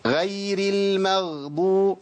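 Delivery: غير said with a light thin غ, drawn out; wrong غ demo. Shown as a demonstration. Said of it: "تسمع ناس تقول لك إيه".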